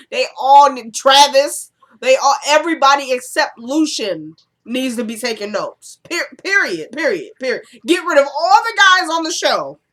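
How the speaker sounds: loud, high exasperated viewer outburst; fired-up rant. Real, not acted. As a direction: shout your verdict on every guy. Fired-up rant about show's men.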